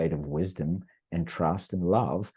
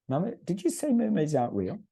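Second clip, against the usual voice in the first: put-on high, indignant little-girl voice; imitating his young daughter; 11 semitones higher than usual. Daughter challenging her mother about mermaids, high childlike indignation. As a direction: pitch it up like an eight-year-old who can't believe it.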